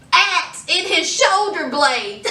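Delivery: normal talking voice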